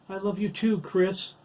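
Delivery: high, loud joyful cry; exclaimed. Acted. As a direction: call it out with sudden joy. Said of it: "Dabney exclaimed".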